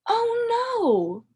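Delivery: playful exaggerated alarm; oh no dismay. A narrator's reading. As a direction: cry out in storybook dismay. Dismay at story mishap.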